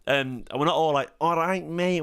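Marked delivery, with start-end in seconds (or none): 1.2-2.0 higher, put-on Manchester accent. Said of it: "We're not all [name]".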